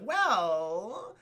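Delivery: long, rising "well"; evasive stalling. Retold or imitated. Jafar dodges the princess's question, drawn-out hedging. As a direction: stretch it upward, reluctant to answer.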